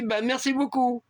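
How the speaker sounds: put-on high, lightly accented thanks; a hint of a Spanish accent, grateful. Driver thanking before hanging up, light Spanish accent. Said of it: "avec un fort accent espagnol".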